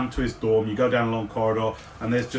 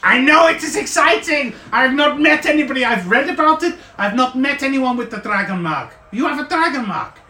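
high, louder, breathless with wonder; excited NPC; 10 semitones higher, 5 dB louder than usual. Acted. Artificer telling player about the mark, excited and louder. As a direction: gush about the rare mark.

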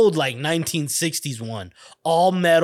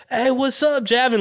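high-pitched voicing of another kid; mock casual kid's hello; imitated greeting; 9 semitones higher than usual. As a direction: hail a buddy in a raised pitch. Retold friendly greeting from Todd.

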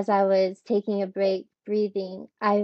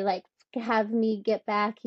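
ordinary delivery

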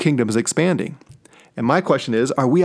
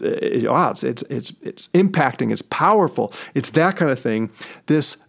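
ordinary delivery

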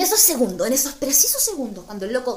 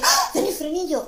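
higher, louder surprised exclamation; 6 semitones higher, 5 dB louder than usual. Retold or imitated. Telling the guy she just kissed that he has braces, surprised. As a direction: react as if you just noticed. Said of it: "y yo le dije".

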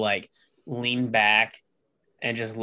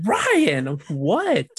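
much higher, startled exclamation; grandma aghast after the dinosaur dies; shocked grandma; 7 semitones higher than usual. Retold or imitated. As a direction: gasp it out in dismay. Grandma shocked at sad movie.

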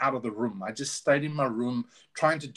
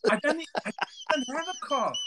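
high, laughing confession; laughing admission; 10 semitones higher than usual. Real, not acted. [name] laughing at friend's tease, high-pitched admission. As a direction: laugh it out, owning the joke.

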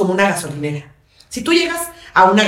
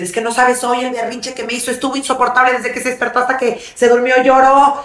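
higher-pitched imitation of a worn-out mother; exasperated complaint; 5 semitones higher than usual. Mother telling therapist about child's tantrum, mock exasperated.

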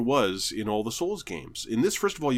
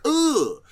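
boss grunt; 6 dB louder than usual. Retold or imitated.

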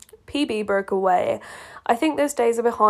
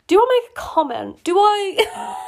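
mock coy; 11 semitones higher, 5 dB louder than usual. Real, not acted. Teasing aside after the quote.

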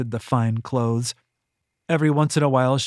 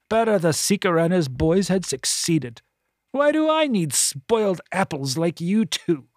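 put-on high, disdainful old woman voice; a scornful, self-pitying matron; bitter voluptuary; 6 semitones higher than usual. A narrator's reading. Voluptuary character voice.

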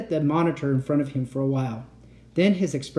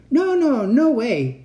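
hasty reassurance; 10 semitones higher, 7 dB louder, slower than usual. Acted. Uncle hastily reassuring.

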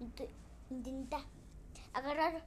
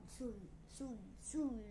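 fading echo, much softer; 3 semitones lower, 5 dB quieter than usual. Acted. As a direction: let the word echo away. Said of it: "¿eso fue un eco?".

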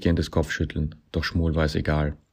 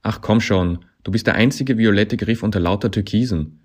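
loud, raised, pleading retort; exasperated coaxing; 4 semitones higher, 6 dB louder than usual. A narrator's reading. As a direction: push back loudly, coaxing him. Coaxing retort in acted quarrel.

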